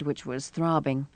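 ordinary delivery, nothing marked

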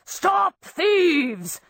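the Baron chasing intruders, calling out; shouting. A narrator's reading. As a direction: yell after the thieves. Baron shouting after fleeing thieves.

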